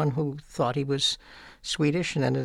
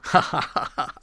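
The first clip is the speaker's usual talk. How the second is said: imitating an aunt's ha-ha laugh; acted-out rolling laughter; imitated laugh; 4 semitones higher, 4 dB louder than usual. Nephew shows how his mother's sister laughed, imitated laugh. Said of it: "She would laugh".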